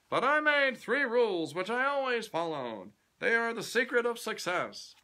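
a self-made boss lecturing a youth; grand old tycoon addressing a young man; pompous company president. Acted. President Kennedy voiced in the story.

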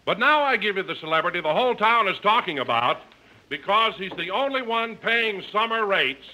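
announcer introducing the star to the audience; announcer intro. Acted. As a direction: build up to the star's entrance. Announcer opening the show.

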